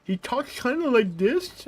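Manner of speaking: high, slow moron voice; put-on dim-witted voice; Idiot Jim. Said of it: "he's good at the voice he does".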